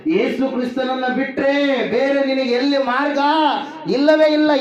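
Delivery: high, emphatic preacher's voice; full-force preaching. Acted. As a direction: declare it with total conviction.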